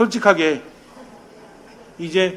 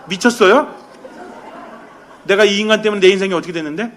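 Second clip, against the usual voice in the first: indignant outburst; 3 semitones higher, 7 dB louder than usual. Acted. Wife snapping at the husband who came back, indignant. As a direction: fire it back like a wronged wife. Wife's imagined reply to the husband.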